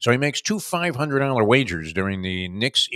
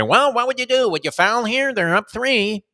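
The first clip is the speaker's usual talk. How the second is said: high, louder mock commentator voice; mimicking the broadcast team's second-guessing; TV announcer; 13 semitones higher, 5 dB louder than usual. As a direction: ham up the booth analyst asking questions. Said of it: "Where they say".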